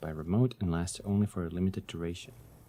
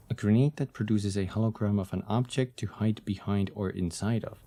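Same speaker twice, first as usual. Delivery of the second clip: ordinary delivery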